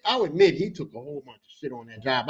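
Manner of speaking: normal talking voice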